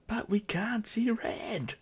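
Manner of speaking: hushed joking whisper; whispered gag about the wire. Acted. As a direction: breathe it as a secret aside. Joke aside about colourblind Kerbals.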